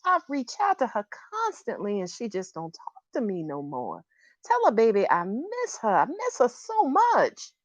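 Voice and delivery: pleading mother, pitch swooping high then dropping; Janet. Acted. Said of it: "Janet is here saying to me".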